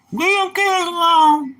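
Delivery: high, loud, slow squeal; mock shrill mother. Mocking a mother who defends her noisy kids, high slow shriek. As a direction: shriek it like an outraged mom.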